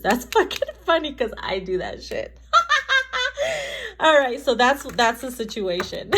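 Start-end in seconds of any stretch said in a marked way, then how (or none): 2.5-3.9 real burst of high laughter; amused high cackle. Real, not acted. Laughing at her own point.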